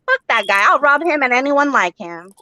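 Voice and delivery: ordinary talk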